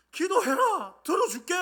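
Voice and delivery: high, loud cry of promise; shouted command. Acted. God promising to hear prayer, commanding shout. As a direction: shout it out as a proclamation.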